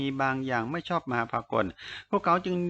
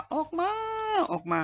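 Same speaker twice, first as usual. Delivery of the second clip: shouting into the forest. A narrator's reading. Xie Lian, red-eyed, calls his hidden enemy out of the forest, shouting. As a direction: holler it out at a far higher pitch. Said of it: "ตะโกนเข้าในป่า".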